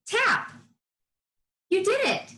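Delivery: "Tap" blended and sung out high; triumphant. Shown as a demonstration. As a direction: land "Tap" high like a reveal. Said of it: "Say the sound with me".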